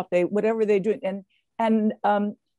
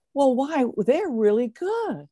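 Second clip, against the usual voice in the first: rising, slowed mock amazement; surprised Jock; 6 semitones higher, slower than usual. Jock Semple, amazed the women run well, slow surprise. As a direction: act astonished that they're good. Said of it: "he said".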